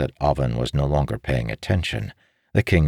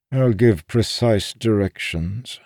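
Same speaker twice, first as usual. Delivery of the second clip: put-on pitched-up, unhurried old man; old schemer instructing; 8 semitones higher than usual. Voiced captive makes his offer.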